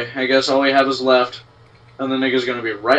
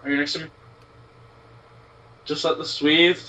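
normal talking voice

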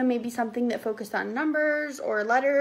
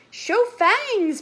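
high, raised call; papa vampire's cry; 8 semitones higher, 4 dB louder than usual. A narrator's reading. Papa vampire to his little vamps, crying out. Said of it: "papa cried".

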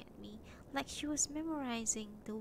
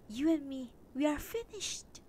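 louder, a touch higher, rising at the end; hammed-up breakup line from the husband; mock final declaration; 6 semitones higher, 6 dB louder than usual. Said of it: "He says, "Sonia".

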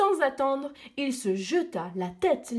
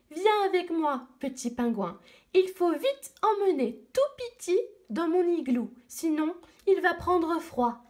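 put-on little girl voice; 7 semitones higher than usual. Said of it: "Puis elle dit".

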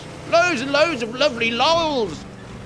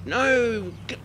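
normal talking voice

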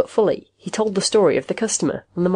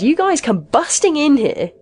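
high-pitched, protesting plea; pleading; 9 semitones higher, 5 dB louder than usual. A narrator's reading. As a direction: appeal loudly against the intrusion. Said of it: "Magnusson pleaded".

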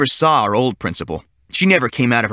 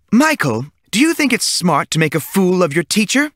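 scolding teacher; 8 semitones higher than usual. A narrator's reading.

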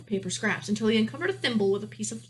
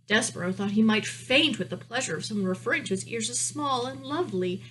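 usual speaking voice

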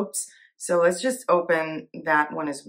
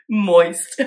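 Moist said louder and stretched out; loud, drawn out; 8 dB louder than usual. Real, not acted. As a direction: punch Moist out loud and long, laughing at the joke. Repeats the joke word louder.

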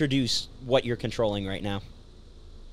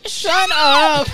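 laughing shout; 7 dB louder than usual. Acted. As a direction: yell then crack up laughing. Shouts back then laughs.

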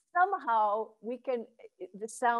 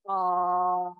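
hara voice vowel; 3 semitones lower than usual. Shown as a demonstration. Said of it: "Try ah".

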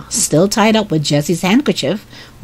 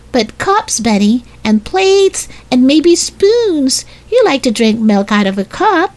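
sister coaxing; 8 semitones higher than usual. A narrator's reading. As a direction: tempt a small child, item by item. Voices Violet coaxing Benny.

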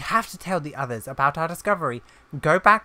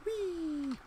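high, gleeful squeal of flight; gleeful whee; 13 semitones higher, 11 dB quieter than usual. Real, not acted. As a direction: squeal with joy as you take off. Flying on a dragon.

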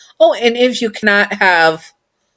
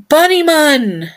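wide-eyed surprise; 10 semitones higher, 3 dB louder, slower than usual. Acted. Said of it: "your eyes wide".

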